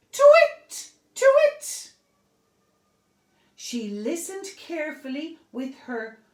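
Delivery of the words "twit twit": high, loud hooting twit; owl calling out. Acted. Olive calls out from her tree, a high owl twit. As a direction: call it across the treetops. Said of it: "and called out".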